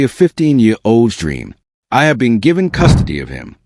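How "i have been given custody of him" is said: usual speaking voice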